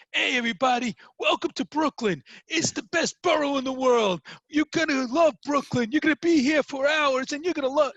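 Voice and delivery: high, loud, booming Brooklyn cheerleader; shouting welcome from the top of the rock; Brooklyn guy on a rock. Retold or imitated. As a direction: hype up the runners like a loud Brooklyn local. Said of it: "and he's going".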